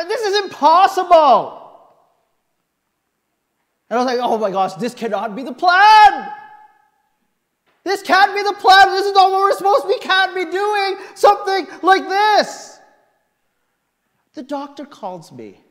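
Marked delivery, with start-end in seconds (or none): 0.0-1.7 high, loud cry of disbelief; shocked exclamation. Retold or imitated. Patient reading search results, loud shocked cry. 4.2-12.8 high, loud desperate protest; panicked shout. Retold or imitated. Patient reacting to the surgery plan, loud panicked shout.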